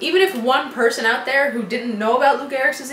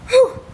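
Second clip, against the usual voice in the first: cold gasp; 6 dB louder than usual. Real, not acted. After the ice bucket.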